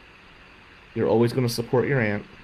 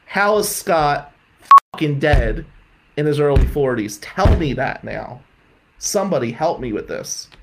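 anguished outburst; 3 semitones higher, 5 dB louder than usual. Real, not acted. Anguished outburst over the death.

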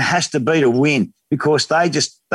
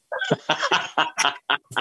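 laughter breaking into the story; real amused laughing; 7 dB quieter than usual. Real, not acted. Guest telling his taxi tale to the hosts, amused laugh.

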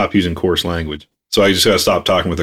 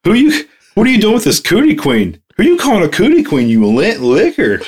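much higher, fast, mock outraged retort; hammed-up indignant insult; 11 semitones higher than usual. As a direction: fire it off like a prissy cartoon insult. Replaying the cootie queen commercial.

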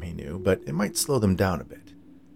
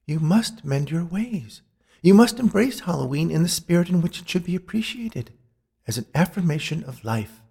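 high, solemn ghostly warning; earnest spirit messenger, pitched up; admonishing ghost woman; 9 semitones higher than usual. A narrator's reading. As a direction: warn him gravely in a raised woman's voice.

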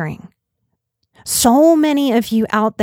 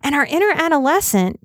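ordinary talk

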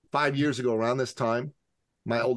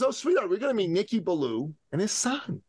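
high, sing-song mommy voice; put-on motherly lilt, pitched up; mother to child; 10 semitones higher than usual. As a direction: imitate a mom indulging her little boy. Voices mother answering her excited boy.

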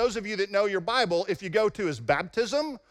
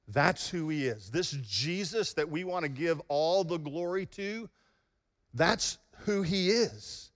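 hushed reverence; 3 semitones lower, 5 dB quieter than usual. Real, not acted. Preacher to the church about Jesus, hushed and reverent. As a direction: draw them in with quiet wonder. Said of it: "sermon climax hushed reverent".

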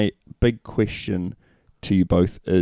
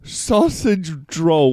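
put-on "Sausage roll" slow and higher in a teasing Kiwi accent; mock Kiwi accent; 6 semitones higher, slower than usual. Teasing his New Zealand co-host, mock Kiwi accent. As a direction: stretch "Sausage roll" like a Kiwi to take the mick. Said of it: "just 'cause I'm a Kiwi doesn't mean you can take the mick".

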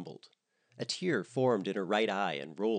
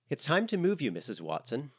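much higher and louder, brisk order; commanding; 6 semitones higher, 4 dB louder than usual. Acted. The nurse orders the patient to be moved, higher and commanding. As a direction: cut in with clinical authority. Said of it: "the nurse commanded".

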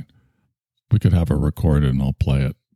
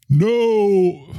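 much higher anguished no; Charlton Heston. Retold or imitated. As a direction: wail it in despair. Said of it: "I can't do the Charlton".

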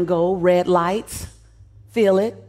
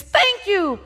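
yelled thanks; 9 semitones higher than usual. Pretend. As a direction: shout it up to the stage. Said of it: "Seat seven thousand eight hundred and fifty-six".

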